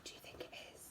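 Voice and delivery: breathy hushed whisper; whispered question. A narrator's reading. Teacher asking the children what it is, whispering. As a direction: drop to a secret whisper.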